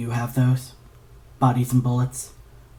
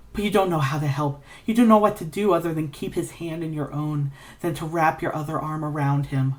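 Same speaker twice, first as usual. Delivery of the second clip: plain reading voice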